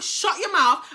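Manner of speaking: retold angry snap. Woman silencing the other girl, angry snap. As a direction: cut her off crossly. Snaps at girl behind the door.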